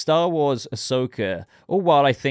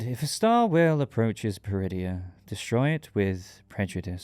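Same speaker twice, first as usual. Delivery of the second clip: Thrawn. Acted. Reviewer quoting the episode's closing line, in a Thrawn impression. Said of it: "trying to do a Thrawn impression".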